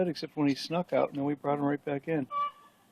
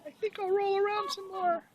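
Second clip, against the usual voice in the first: high, delighted cat voice for the pet; voicing the cat. Owner speaking for his indoor cat rolling in tar, high, delighted voice.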